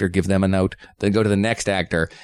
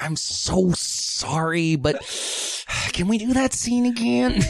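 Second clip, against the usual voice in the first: drawn-out sorry, then a sigh and a high plea; mock timid director asking for a retake; apologetic director; 8 semitones higher, slower than usual. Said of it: "any director who was like".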